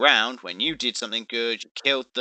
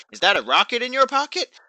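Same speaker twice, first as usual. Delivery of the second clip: teasing taunt; 5 dB louder than usual. Retold or imitated. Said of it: "continuously saying".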